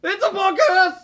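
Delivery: high loud slow announcer yell; catchphrase shout. Acted. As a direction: belt out the catchphrase. Gag catchphrase.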